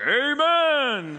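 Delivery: a high, loud mock churchgoer's amen; a hearty congregant's loud amen. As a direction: shout it from the pews. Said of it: "Some dude in first service is like".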